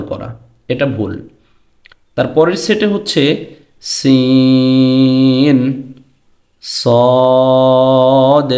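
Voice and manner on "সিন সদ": slow drawn-out সিন then সদ; Arabic letter sounds. Shown as a demonstration. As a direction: hold each letter long to show the difference. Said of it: "পার্থক্য আছে".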